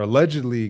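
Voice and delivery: "allegedly" given pointed emphasis to flag doubt; stress on allegedly. Real, not acted. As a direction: lean firmly on "allegedly". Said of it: "with a strong em".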